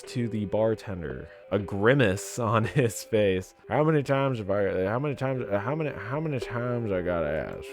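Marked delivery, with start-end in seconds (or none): 3.7-7.7 gruff sheriff. Acted. Sheriff scolding the bartender, fed up and gruff. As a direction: keep asking, worn-out lawman. Sheriff voicing exasperated repeated question.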